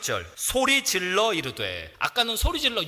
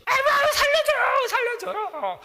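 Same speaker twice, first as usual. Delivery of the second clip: very high, loud panicked wail; mock cry for help; 4 dB louder than usual. Preacher mocking a drowning believer, panicked. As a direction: yelp like someone going under.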